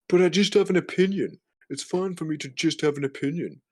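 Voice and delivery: high, put-on whiny plea; mock whiner. Mocks someone whining about having opinion.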